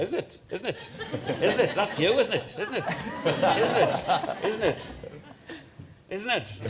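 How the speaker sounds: high-pitched Northumberland catchphrase; insistent high Northumberland mimicry; imitated Northumberland man. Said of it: "it was in Northumberland. He said".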